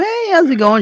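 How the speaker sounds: a high chirpy imitation of the friend saying hello; cheery greeting. Remy greeting them.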